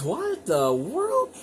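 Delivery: high, slow startled what the world; surprised. Real, not acted. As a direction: caught off guard by something odd. Surprised at unexpected radio find.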